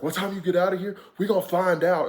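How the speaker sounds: high, loud mock threat; threatening tough guy. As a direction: bark it at him like you want a fight. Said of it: "like, ready to fight this dude".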